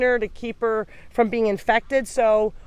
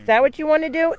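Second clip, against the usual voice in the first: higher, louder cooing question to a pet; 7 semitones higher, 5 dB louder, much faster than usual. Real, not acted. Rescuer coos at duckling climbing into her shirt, higher pet talk.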